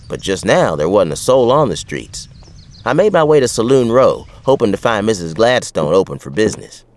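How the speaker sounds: plain reading voice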